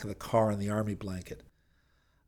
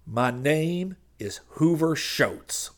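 slow, higher growled announcement; growled retort; 6 semitones higher, 3 dB louder, much slower than usual. A narrator's reading. Preacher with his head in the door, growled retort. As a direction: announce the name slowly, with menace. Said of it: "the man with his head in the door growled".

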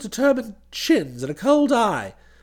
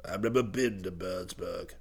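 put-on low, soft old gentleman; rattled old boy; 13 dB quieter than usual. A narrator's reading. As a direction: murmur it low, slightly rattled. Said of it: "The old boy seemed a bit rattled".

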